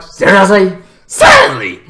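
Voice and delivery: loud, rising into a very high squeak; incredulous. Retold or imitated. Reacting to keeping the goblin as a pet, squeaky disbelief. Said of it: "And I'm like".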